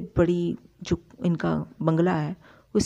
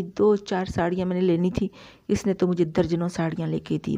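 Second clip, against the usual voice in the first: ordinary talk, nothing marked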